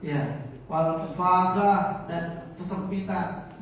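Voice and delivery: loud, slow recitation with translation; religious text reading. Acted. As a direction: read out the kitab line by line, drawn out. Reads and translates Arabic text.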